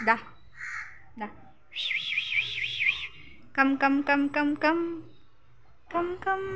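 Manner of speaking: high, sing-song call to the dog; coaxing call. Real, not acted. Owner calling her dog Vicky to come over, in a high coaxing voice. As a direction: coax the dog over sweetly.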